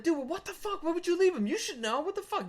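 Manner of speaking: high-pitched protest; acted indignant scolding. Storyteller taking Bernie to task, high indignant voice. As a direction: re-enact scolding a friend in disbelief.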